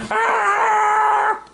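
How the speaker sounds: very high, drawn-out shriek; mock terrified scream. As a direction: shriek long and high in play terror. Threatened figure screams.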